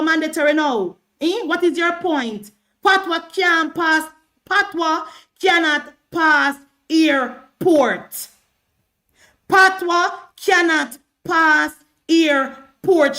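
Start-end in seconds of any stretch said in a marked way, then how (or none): 4.5-13.2 very slow, each word separated and stressed; emphatic word by word. Real, not acted. Live speaker arguing with Sheldon that Patwa won't travel, slow and emphatic. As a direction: hammer it out one word at a time.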